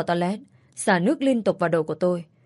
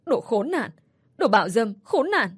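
higher and higher, ending in a high cry; desperate cursing; 4 semitones higher than usual. Acted. Cursing the man forcing himself on her, climbing to a cry. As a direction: hurl the insults at him. Cursing him during assault.

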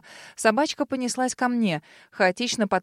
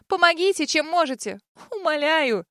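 desperate plea; 6 semitones higher than usual. Acted. Narrator begs in panic.

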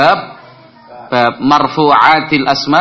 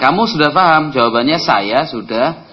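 ordinary talk, nothing marked

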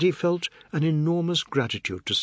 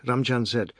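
ordinary delivery, nothing marked

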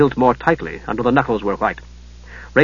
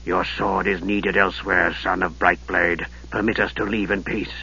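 low, soft, sinister mage; Raistlin's voice; 6 semitones lower, 8 dB quieter than usual. Acted. Raistlin greeting his nephew, low and softly sinister. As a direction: hush it like a frail dark wizard.

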